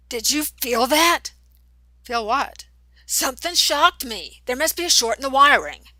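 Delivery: jolted and rattled, demanding; startled alarm. A narrator's reading.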